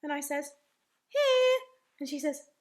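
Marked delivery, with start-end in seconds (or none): 1.1-1.6 loud, high, sing-out reply; calling out. Acted. Invisible boy answering his mummy, calling out. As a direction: call out high so you are found.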